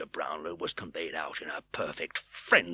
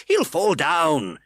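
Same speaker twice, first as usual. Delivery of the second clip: alarmed; 9 semitones higher, 8 dB louder, much slower than usual. A narrator's reading. Brownlow alarmed.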